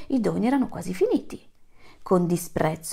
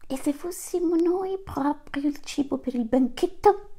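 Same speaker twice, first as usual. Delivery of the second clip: worried antelope; 8 semitones higher than usual. Acted. Antelope worries aloud in story.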